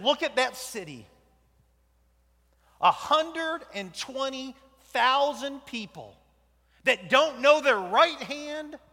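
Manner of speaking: loud, high and slow declaration; loud proclamation. Acted. God showing Jonah the city, loud and slow. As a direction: call it out over the whole city.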